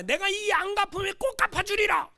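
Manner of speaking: high, loud acted shout; vengeful vow. Preacher voicing our grudge against an enemy, shouted vow. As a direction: swear payback at full voice.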